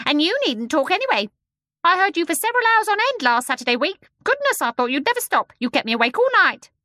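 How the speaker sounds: very high, loud, fast bird voice; a scolding, chattering bird; shrill starling. A narrator's reading. The starling scolding the babies from the window.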